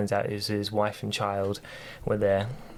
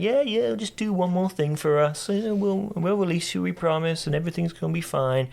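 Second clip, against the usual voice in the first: high, breezy fobbing-off voice; a sly god stringing him along with empty promises; mock placating god; 8 semitones higher than usual. Athena brushing off Kratos, high and mock-soothing. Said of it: "he's like".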